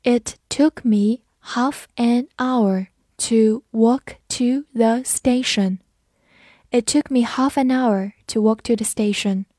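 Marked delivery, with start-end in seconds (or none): 0.0-5.8 slow, clear read of the whole sentence. Shown as a demonstration. Teacher reading the sentence slowly for students. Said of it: "我们慢读一遍".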